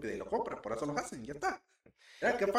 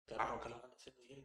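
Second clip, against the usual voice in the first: imitating his friend; 10 dB quieter, much faster than usual. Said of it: "¿Así me la saliste cuando lo dijiste?".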